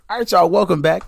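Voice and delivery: loud, high radio welcome back; announcer welcome. Acted. Podcast host welcoming listeners back from the break, loud and high. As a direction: greet listeners like coming back on air.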